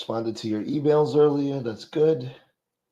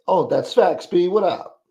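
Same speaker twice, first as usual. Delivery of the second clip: ordinary delivery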